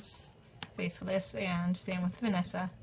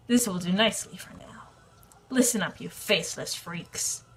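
sneering panto villain; 4 dB louder than usual. Acted. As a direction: taunt like a cartoon overlord. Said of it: "Ten out of ten voice acting".

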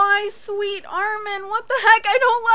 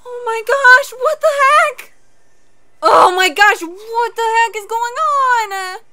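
raised, agitated shocked voice; loud disbelief; 4 semitones higher, 10 dB louder than usual. Real, not acted. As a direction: exclaim it loudly in shock. Shocked reaction to the show.

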